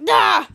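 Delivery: short, loud acted cry; quick mock yelp on being hit; startled yelp. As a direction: blurt a loud "ah". Monster hit by thrown pillow.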